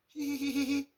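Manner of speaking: high girlish giggle, mimicked; imitated giggle. Teacher imitating the girl who still giggles. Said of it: "She still giggles".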